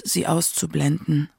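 usual speaking voice

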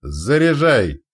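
loud, high, stretched command; slow-rolling commander's roar; barked order. A narrator's reading. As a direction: bellow the order to load. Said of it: "рявкнул командир расчёта".

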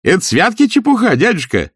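high-pitched, astonished query; incredulous echo question. A narrator's reading. Nephew questioning his uncle's dismissal, incredulous. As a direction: question it back in disbelief. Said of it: "переспросил племянник".

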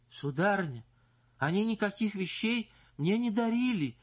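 high shrill-pitched protest; squeal. Acted. Singer to the visiting wife, high squealing protest. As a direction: squeal it out in denial. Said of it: "взвизгнула Паша".

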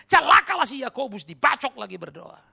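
loud, high sarcastic outburst; mock condemnation. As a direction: proclaim a mock curse forcefully.

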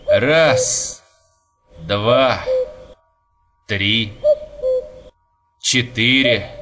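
one word at a time; slow counting. A narrator's reading. Young man counts the cuckoo's calls, slow counting. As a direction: count slowly, with pauses between numbers. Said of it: "стал считать молодой человек".

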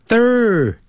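Thur- with tongue between teeth, pulled back fast. Shown as a demonstration. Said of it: "舌头先吐出来". (Thur-先吐舌头再快速缩回)

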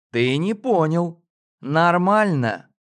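«Нормально» с сильным нажимом; медленно, с нажимом на «Нормально»; emphatic нормально. Acted. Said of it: "с нажимом произнесла девушка". (с нажимом, «Нормально» по слогам)

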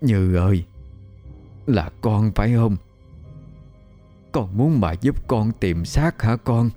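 grandma speaking to the ghost, understated grief; choked-up grandmother. A narrator's reading. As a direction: gently ask the dead girl, holding back tears. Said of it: "Bà cất giọng nghẹn ngào".

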